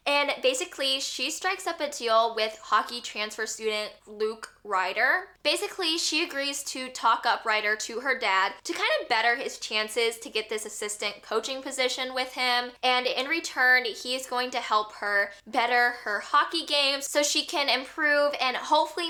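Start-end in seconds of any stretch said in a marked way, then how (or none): none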